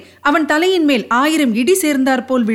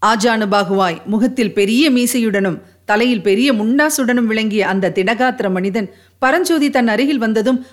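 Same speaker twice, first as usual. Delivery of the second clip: usual speaking voice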